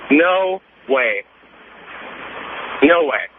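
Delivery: normal talking voice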